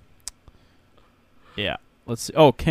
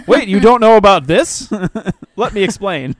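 mock exposition dialogue; 5 semitones higher, 9 dB louder than usual. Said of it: "They're like".

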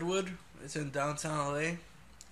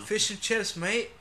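higher, louder mock British; mock British accent; 5 semitones higher, 5 dB louder, slower than usual. Co-host teasing about British pub food, mock British accent. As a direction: put on a jokey British accent.

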